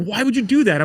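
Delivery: mock the dad's outrage at him; exasperated father.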